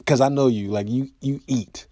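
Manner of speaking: ordinary talk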